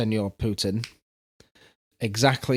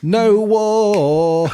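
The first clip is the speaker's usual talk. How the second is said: loud high shout to end the jingle; big finish; 9 semitones higher, 8 dB louder than usual. Pretend. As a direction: punch it out as the final line.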